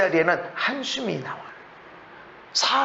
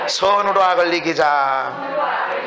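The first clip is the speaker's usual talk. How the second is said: slow, shouted 선으로 악을 이기자, stress on 선으로; congregation shout; 5 semitones lower, much slower than usual. Shown as a demonstration. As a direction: proclaim 선으로 악을 이기자 back as a crowd. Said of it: "따라합시다".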